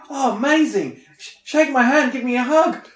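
high, loud, gushing mock of the French charity bloke; overexcited charity man. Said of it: "in, like, really broken English".

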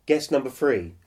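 usual speaking voice

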